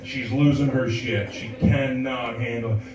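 usual speaking voice

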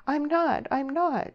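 high, wounded protest; hurt cry. A narrator's reading. Deborah denying she is half-hearted, deeply hurt. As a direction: cry out in hurt, pitched right up. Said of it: "cried Deborah, deeply hurt".

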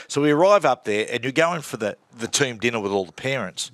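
ordinary talk, nothing marked

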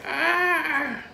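put-on high shrill old lady yelp; old grandma cry. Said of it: "like an old grandma, like, holding her head going like".

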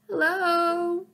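high, drawn-out scratchy hello; faint scratchy voice. A narrator's reading. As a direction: sound like a voice crackling from a speaker. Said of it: "said a faint, scratchy voice".

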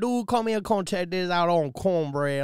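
high, hammed-up Cajun voice; mock Cajun accent. Host riffing on accent phrases, mock Cajun. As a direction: play a Cajun calling a pal over. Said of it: "The only phrase in an accent".

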